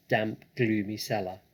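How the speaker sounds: plain reading voice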